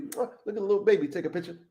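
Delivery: put-on fast, high cooing over a baby; a lightly voiced president kissing babies; imitating the president. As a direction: mimic a politician kissing a baby. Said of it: "Like the president".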